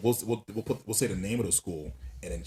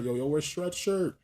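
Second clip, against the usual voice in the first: calling out; 9 semitones higher, 7 dB louder than usual. Retold or imitated. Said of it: "you see a person down the street".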